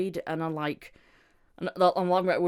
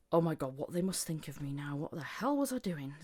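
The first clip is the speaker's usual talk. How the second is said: hushed mock self-reproach; anxious inner thought; 8 dB quieter than usual. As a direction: softly act out fretting to yourself. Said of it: "that sort of thought process".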